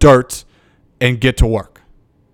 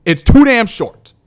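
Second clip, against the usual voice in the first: fired-up repeat; 6 semitones higher than usual. Real, not acted. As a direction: say it again like you mean it. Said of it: "I'll say it a thousand times".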